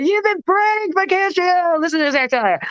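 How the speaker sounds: hype announcer. Pretend. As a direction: announce the prank to the crowd. Prank announcement to the crowd.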